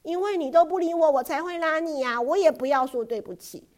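put-on loud, high defiant child; a stubborn girl talking back loudly. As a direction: refuse to back down. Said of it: "艾莉不服气".

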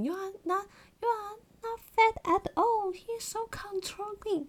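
high, slow mock-indignant reassurance; lightly voiced American friend insisting. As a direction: friend defending her looks. American friend reassuring her, incredulous.

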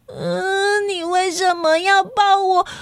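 high, slow, near tears; a frightened child pleading in her head; small scared voice, close to crying; scared little girl. Acted. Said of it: "苏丽在心里想说".

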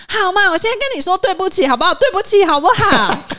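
high, loud, fast sarcastic sorry, breaking into a laugh; grudging mock apology. The aunt throws a sarcastic sorry at the contractor, laughing. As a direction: toss off an exaggerated fake apology.

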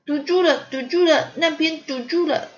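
put-on high, insistent child voice; little girl character. A narrator's reading. Ponyo pointing out the blocked pipe, insistent.

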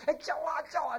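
high-pitched mock clamour; imitating crying out. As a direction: pitch it high as if yelling in pain. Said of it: "就叫那个". (模仿高声喊叫)

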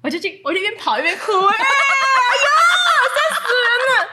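high, loud laughing exclamation; shrieking with laughter. Real, not acted. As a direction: laugh out the absurdity. Laughing retelling of crying run.

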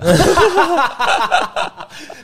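high falling laugh; burst of laughter. Real, not acted. Guide laughing at what the little girl said. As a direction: laugh hard.

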